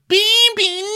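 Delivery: put-on very high, loud squeal of baby; shrieking falsetto. Victoria greeting guests, high squealing falsetto. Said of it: "she's like".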